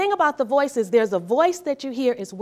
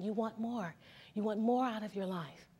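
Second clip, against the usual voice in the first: hushed, soft voice from within; soft inner voice; 10 dB quieter than usual. Acted. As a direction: murmur it like a voice inside the head. The inner voice persisting.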